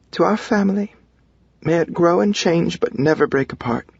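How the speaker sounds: high-pitched motherly voice; mom's toast. A narrator's reading. Mom toasting the family as glasses clink, high-pitched voice. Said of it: "my mom says".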